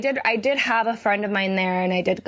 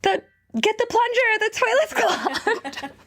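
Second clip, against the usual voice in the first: high-pitched holler for someone in another room; re-enacted frantic call for help; retold yell; 11 semitones higher than usual. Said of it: "I yell".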